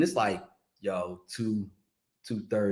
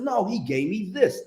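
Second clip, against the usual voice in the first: drawn out slowly, a little higher; slow motion; 7 semitones higher, slower than usual. Shown as a demonstration. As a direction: stretch it out like a slow-motion replay. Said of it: "In slow motion".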